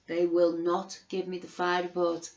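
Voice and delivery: usual speaking voice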